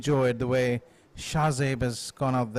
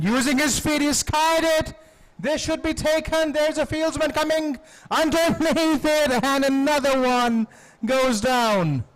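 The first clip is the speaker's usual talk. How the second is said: excited commentary; 11 semitones higher, 4 dB louder than usual. Real, not acted. Catch skied, tense play-by-play.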